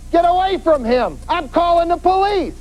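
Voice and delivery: high-pitched demonstrated call to an attacker; yelling out. Shown as a demonstration. Passing driver warning off an attacker, demonstrated high yelling. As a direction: call it out high, as if through a car window. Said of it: "you yell out that window".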